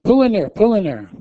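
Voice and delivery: high, loud repeated order; retold yelling. Wife yelling at her husband to pull in, retold yell. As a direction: shout him into the lot. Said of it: "I'm yelling".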